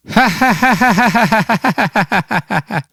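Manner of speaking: high, long burst of laughter; grandfather bursting out laughing. A narrator's reading. Grandfather laughing at the boy's worry, high hearty laugh. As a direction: let out a big amused laugh. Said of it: "éclate de rire".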